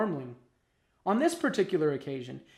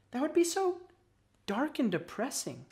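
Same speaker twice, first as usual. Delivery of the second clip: put-on higher-pitched young worm character; Cassie's voice; 9 semitones higher than usual. A narrator's reading. Worm friend imagining life underground, in higher voice.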